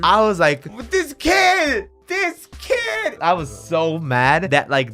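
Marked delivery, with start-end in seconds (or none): 0.9-3.2 high furious shout, half joking; mock furious. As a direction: rage at the kid, hammed up.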